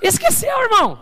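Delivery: high sudden cry on Esqueceu; exclaiming. Acted. Pastor cries out to the congregation, high exclamation. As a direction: call it out in outrage to the church.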